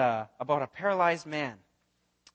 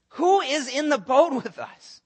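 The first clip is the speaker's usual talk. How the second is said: higher, louder, slow stunned question; loud amazed; 8 semitones higher, 7 dB louder, slower than usual. Acted. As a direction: call it out in wonder. Disciples marvel, first take.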